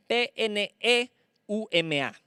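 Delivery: P N E U M A slowly, one letter at a time; P N E U M A spelled slowly; spelling letter by letter. Shown as a demonstration. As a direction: spell it out for the congregation. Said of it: "con acento en la E".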